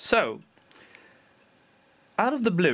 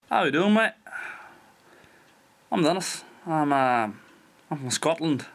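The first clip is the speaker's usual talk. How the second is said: higher, vaguely Scottish accent; rough Scottish accent; 4 semitones higher than usual. Shown as a demonstration. Dutch vlogger showing viewers his makeshift accent, vague Scottish accent. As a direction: attempt a shaky-authentic Scots or Irish accent. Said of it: "the only thing that I can do that somewhat vaguely resembles".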